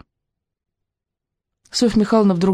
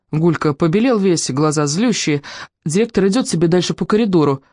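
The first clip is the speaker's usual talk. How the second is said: ordinary delivery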